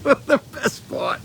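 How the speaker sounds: ordinary delivery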